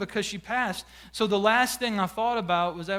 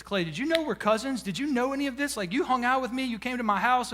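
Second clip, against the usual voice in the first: high-pitched, excited retold questions; imitating an excited friend; 6 semitones higher than usual. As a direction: voice jumps up like a friend bursting with news. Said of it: "he's, he's excited".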